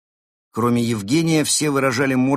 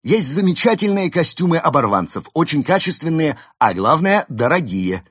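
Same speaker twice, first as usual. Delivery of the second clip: high, loud salesman's patter; eager sales pitch; 8 semitones higher, 6 dB louder than usual. Acted. Panda shopkeeper pushing expensive costumes on customers, eager sales pitch. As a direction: pitch the wares high and keen.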